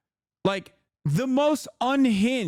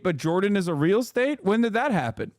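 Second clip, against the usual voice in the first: ordinary talk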